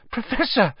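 high, startled cry of a schoolboy; shocked, high-pitched exclamation; alarmed gasp. A narrator's reading. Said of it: "Harry gasped".

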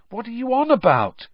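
high, loud, startled question; surprised. A narrator's reading. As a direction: ask it in open surprise. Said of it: "said Ron in surprise".

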